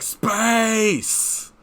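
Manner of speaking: high, drawn-out hammy cry; over-the-top, high-pitched exclamation; Tim Curry. Retold or imitated. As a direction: imitate a hammed-up video game performance. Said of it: "where he's like".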